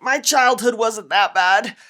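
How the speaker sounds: loud, slow, effortful protest; acted denial under strain. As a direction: push the words out as if holding off a heavy weight. Acts out resisting grief, brick held.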